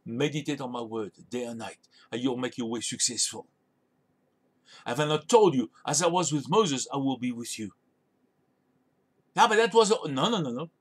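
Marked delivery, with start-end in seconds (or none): 0.1-7.7 gentle reassurance. Retold or imitated. God gently instructing Joshua.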